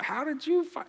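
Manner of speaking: caught-out squeak, high and startled; flustered excuse mode. Retold or imitated. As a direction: scramble for an excuse. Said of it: "go into excuse mode".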